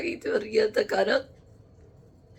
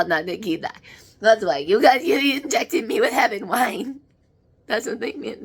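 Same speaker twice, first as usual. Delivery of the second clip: ordinary delivery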